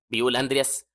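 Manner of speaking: ordinary delivery, nothing marked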